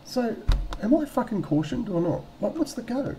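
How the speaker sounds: very high, puzzled inner voice; high-pitched mock bafflement; impatient wondering. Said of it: "I'm thinking".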